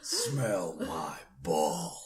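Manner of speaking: very slow, low and soft; mock film hero. Joking as the Sparta hero, low and slow. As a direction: drag it out low, mock-epic.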